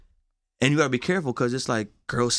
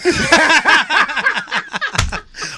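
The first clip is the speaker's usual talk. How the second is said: high, loud burst of laughter; loud high cackle; big laugh; 6 dB louder than usual. Real, not acted. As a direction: crack up hard. Guest laughs at forgetting party.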